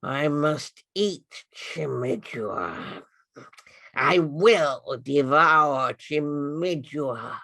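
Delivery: the sleeping king now clearly threatening her; clearer snore-words. Acted. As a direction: let the hunger come through plainly. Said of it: "this time the words were clearer".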